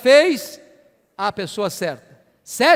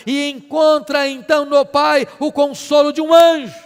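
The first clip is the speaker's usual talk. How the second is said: raised, ringing pulpit delivery building to a loud climax; fervent preaching; 4 semitones higher, 6 dB louder than usual. Real, not acted. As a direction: proclaim it with mounting intensity. Preaches at full force.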